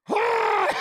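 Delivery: sudden high exasperated shout; frustrated yell. Retold or imitated. Coach reacting as his fighter gives up, high frustrated yell. Frustrated when Pat quits.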